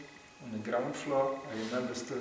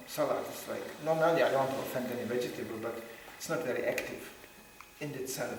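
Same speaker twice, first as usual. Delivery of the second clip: usual speaking voice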